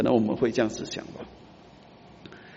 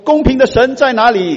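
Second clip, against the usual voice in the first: much higher, louder demanding cry; mock crowd demand; 10 semitones higher, 9 dB louder than usual. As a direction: call out like complainers. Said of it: "他们怎么说呢". (会众大声质问神)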